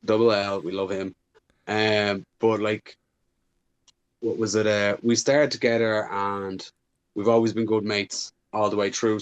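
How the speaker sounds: normal talking voice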